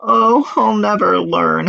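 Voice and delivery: high, loud, slow wail; howling. A narrator's reading. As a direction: howl in despair. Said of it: "howled Big Anthony".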